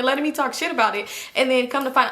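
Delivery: ordinary talk, nothing marked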